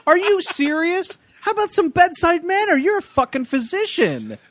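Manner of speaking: high-pitched, laughing disbelief; appalled and amused, pitch way up; incredulous outrage. Real, not acted. Outraged at the doctor's line.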